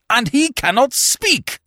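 high, loud, slow cry of despair; anguished exclamation. Acted. Lamenting to the others that Smith cannot speak, high anguished cry. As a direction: wail it out in dismay.

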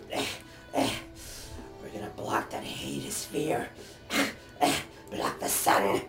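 rasping whispered hag voice; old hag. Acted. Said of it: "in the hag's voice".

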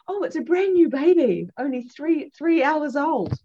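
put-on gushing new-baby reveal; proud delight. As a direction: share the news with pride. Midwife reveals the newborn baby.

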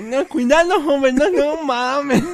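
high, loud, slow mock nasal warning; nasal passenger imitation. Friend re-imitates the nasal woman, high, loud and slow. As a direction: garble it like the nasal woman again. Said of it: "Y nomás faltó el gallego".

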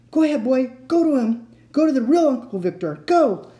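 high, loud eager urging; urging the dog. A narrator's reading. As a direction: cheer the dog on.

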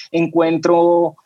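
usual speaking voice

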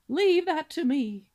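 pompous cob swan. Acted. Father swan listing his son's virtues, grandly booming. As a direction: declaim like a self-important father swan.

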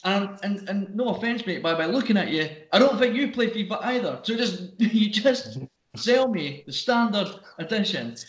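high-pitched, halting mock exasperation; retold fed-up rebuke, slowing at the end; exasperated customer. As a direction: plead with a pushy salesman to just sell it.

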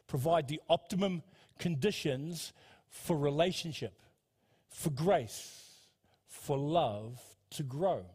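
slow, phrase by phrase with pauses; slow right down and space out each phrase; deliberately slowed down. Real, not acted. Preacher correcting talking too fast, deliberately slowed down. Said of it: "I'm talking too fast".

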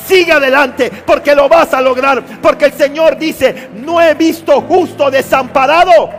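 loud, high sermon voice rising to a shouted climax; impassioned preaching, building. Real, not acted. Preacher assures weary believers angels will sustain them, building to full-force preaching. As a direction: rouse the congregation with mounting force.

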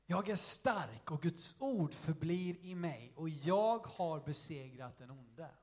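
slow, low, solemn recitation; scripture declaration. A narrator's reading. Preacher recites a verse to the congregation, slow and solemn. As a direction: declare the verse slowly, word by word.

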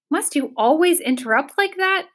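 high-pitched irritated complaint; snippy, exasperated question; irritable. A narrator's reading. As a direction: scold the interrupter irritably. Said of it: "said Tok irritably".

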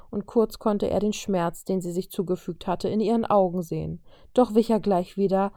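ordinary delivery

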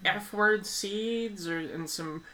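high, slow imitation of Annie fuming; mock Annie rant. Retelling Annie's tangent about swearing, slow high mock rant. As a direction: drag it out like an outraged prude. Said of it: "goes off on this huge tangent".

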